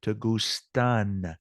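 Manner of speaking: slow, leaning on the -an of "gustan"; stress on gustan. Shown as a demonstration. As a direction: draw out "gustan" so the final n is clear. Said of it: "this is where you change it from gusta".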